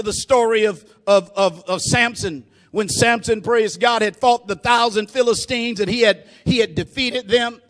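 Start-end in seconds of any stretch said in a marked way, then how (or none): none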